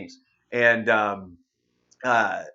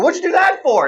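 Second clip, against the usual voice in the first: high, loud outraged comeback; loud aggrieved husband; indignant retort; 9 dB louder than usual. Retold or imitated. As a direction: fire it back at her. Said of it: "I go".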